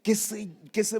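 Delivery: moved almost to tears; choked with emotion. Real, not acted. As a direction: voice cracking as he remembers. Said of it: "mis lágrimas empezaron".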